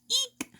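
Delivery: startled squeal. Acted. Doorbell startles narrator's character.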